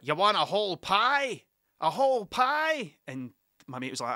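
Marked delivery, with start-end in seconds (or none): 0.0-3.0 loud, drawn-out American impression; exaggerated American disbelief, higher and louder on the repeat; New York pizza seller. Pizza seller questioning a tourist's order, incredulous New Yorker.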